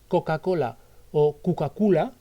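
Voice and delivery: "Coca-Cola" with clear o, then "Cucacula" with u; Arabic-style vowel blur on "Coca-Cola"; o versus u. Shown as a demonstration. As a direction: swap the o sounds of "Coca-Cola" for u, saying "Cucacula". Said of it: "Es una cuestión de acento".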